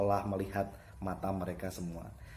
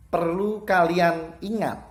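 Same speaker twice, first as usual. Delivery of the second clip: "Perlu kalian ingat" slow, high and loud; emphasis on INGAT; 9 semitones higher, 9 dB louder, slower than usual. Shown as a demonstration. Teacher demonstrates emphasis to pupils, stressing "ingat" strongly. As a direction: drive home "ingat" with slow, loud weight. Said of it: "ditekankan ketika ngomong".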